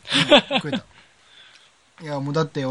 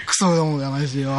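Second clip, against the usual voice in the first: normal talking voice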